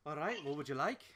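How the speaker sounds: lightly done Scottish-Irish barkeep; Scottish-Irish accent. Acted. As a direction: take the order with a Scottish-Irish lilt. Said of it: "Scottish-Irish accent".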